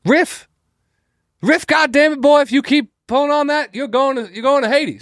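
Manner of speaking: high-pitched mock Southern father shouting; southern accent. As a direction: holler like an angry Southern daddy. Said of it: "a southern accent or something".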